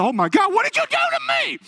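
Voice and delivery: shooting up into a very high mock shriek; freaked out, scared. Healed man to preacher, imitated scared high shriek. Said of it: "He gets so freaked out and scared".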